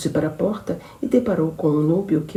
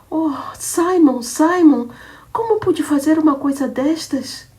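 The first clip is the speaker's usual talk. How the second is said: climbing from higher to much higher, anguished; rising panic; 8 semitones higher, 5 dB louder than usual. Acted. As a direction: panic grows with each line. Shooter panics over wounded lover. (pânico crescente, cada vez mais agudo)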